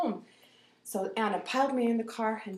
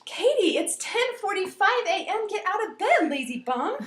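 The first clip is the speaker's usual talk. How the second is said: high, loud scolding mom; imitated mom's wake-up call; 10 semitones higher, 5 dB louder than usual. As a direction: call a lazy kid out of bed. Mom waking her up.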